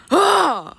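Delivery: short high frustrated yelp. Real, not acted. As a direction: blurt a frustrated ah. Frustrated yelp over word.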